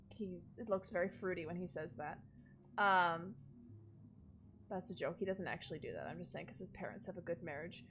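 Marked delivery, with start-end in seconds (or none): none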